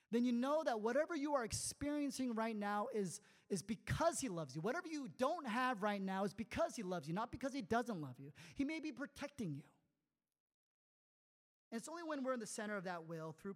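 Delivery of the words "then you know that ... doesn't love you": loud, emphatic preaching; preaching at full force. Acted. Pastor urging the congregation, loud and emphatic. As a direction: drive the point home to the congregation.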